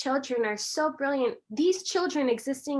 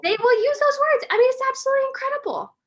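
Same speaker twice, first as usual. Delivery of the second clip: much higher, louder and fast, bursting with delight; amazed excitement; 7 dB louder, faster than usual. Real, not acted. Marvelling at her children's words.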